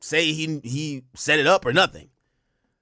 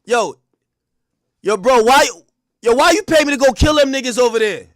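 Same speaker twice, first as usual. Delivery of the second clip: mock loud accusation; 7 semitones higher, 7 dB louder than usual. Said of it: "be like".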